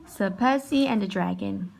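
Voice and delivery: plain reading voice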